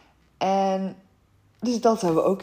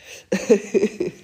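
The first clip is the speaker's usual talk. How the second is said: burst of laughter; 3 semitones higher, 8 dB louder than usual. Real, not acted. Laughing at spicy burn.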